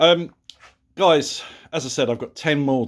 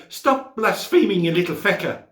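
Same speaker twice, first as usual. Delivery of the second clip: scolding Irish mum, higher and louder; bad Irish accent; 7 semitones higher, 5 dB louder than usual. Friend's mum scolding boys who swore, bad Irish accent. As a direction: put on a rough Irish accent to tell them off. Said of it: "sorry if that's a bad Irish accent".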